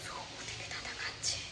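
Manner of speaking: soft secret whisper while sneaking. Real, not acted. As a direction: barely breathe the words, hiding. Sneaking past grandfather while hiding.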